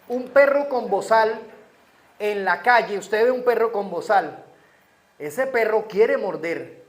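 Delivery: loud, fervent preaching from the pulpit; full-force preaching. Acted. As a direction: drive it home to the congregation.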